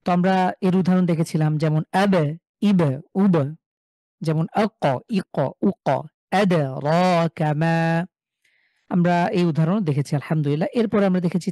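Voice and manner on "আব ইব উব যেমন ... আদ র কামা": আব, ইব, উব with a small bouncing push on the final letter; qalqala bounce. Shown as a demonstration. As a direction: give the stopped ব and ক a short echoing jolt. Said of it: "কলকলা করে পড়ি বা ধাক্কা দিয়ে পড়ি".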